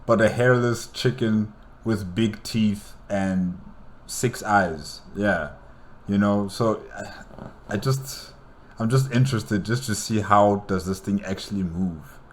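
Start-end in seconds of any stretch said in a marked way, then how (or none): none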